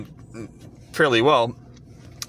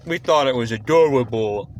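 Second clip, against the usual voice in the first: lightly mocking cutesy voice; Eric Kripke; 3 semitones lower than usual. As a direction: mimic him gushing over it, put-on voice. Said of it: "And he says".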